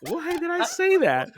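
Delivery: high, drawn-out real dismay; mortified self-reproach. Real, not acted. Speaker regretting an accidental innuendo before friends, mortified.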